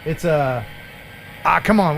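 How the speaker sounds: ordinary talk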